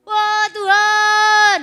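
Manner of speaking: high, slow, drawn-out loud cry of "Wah Tuhan"; desperate cry to God. Retold or imitated. Preacher reenacting her cry for help, slow and loud. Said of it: "Setiap kita teriak minta tolong".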